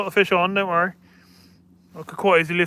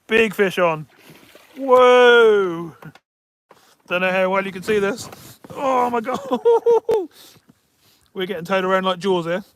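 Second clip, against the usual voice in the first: loud, thrilled narration; excited; 4 semitones higher, 9 dB louder, slower than usual. Real, not acted. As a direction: talk loud and thrilled while fighting. Fighting big fish.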